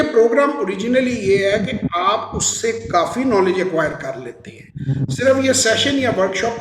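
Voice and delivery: ordinary delivery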